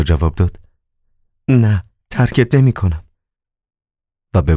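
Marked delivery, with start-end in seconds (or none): 1.5-3.0 higher-pitched woman's character voice; Margarita, refusing. Acted. Margarita refusing to leave the master, higher voice. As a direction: answer in a higher voice.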